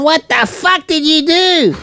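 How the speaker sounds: high-pitched, drawn-out indignant shriek; mock outrage. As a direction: imitate an outraged accuser, stretching the words high. Acted quarrel at burnt front door.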